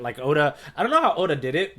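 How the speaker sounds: ordinary talk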